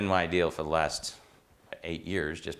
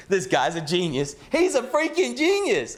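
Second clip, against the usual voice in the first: much higher and louder, slow on the second line; hammed-up praise; 6 dB louder than usual. Old mentor crowing to others about the student, high hammed-up praise. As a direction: crow it like a proud old-timer.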